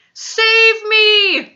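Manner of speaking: high, loud cry for rescue; terrified scream. A narrator's reading. Giant in a nightmare calling for rescue, terrified scream. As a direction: scream for help. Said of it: "screamed the flesh lump eater".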